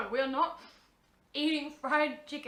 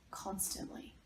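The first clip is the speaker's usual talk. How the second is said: whispered; 3 semitones lower, 11 dB quieter, slower than usual. Acted. Whispered aside after list item.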